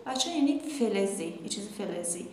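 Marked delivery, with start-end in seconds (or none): none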